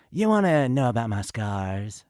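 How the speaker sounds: Seth voice impression. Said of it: "I love his whole".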